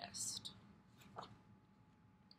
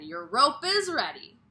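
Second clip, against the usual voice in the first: louder, bright call; bird calling; 4 semitones higher, 10 dB louder than usual. Acted. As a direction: announce it proudly. Bird announces rope ready.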